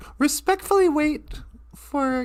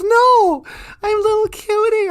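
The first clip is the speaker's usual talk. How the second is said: higher-voiced little teddy bear; protesting teddy bear; 5 semitones higher, 4 dB louder than usual. Teddy bear correcting the eagle, higher protest. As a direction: pipe up to set the record straight.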